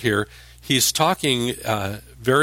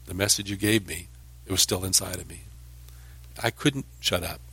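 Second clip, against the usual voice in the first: softly and lower, like a secret admission; hushed confession; 3 semitones lower, 8 dB quieter than usual. Retold or imitated. Jeremiah quietly admitting the message still burns inside, softer. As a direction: drop the voice, inward and intimate. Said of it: "So Jeremiah says".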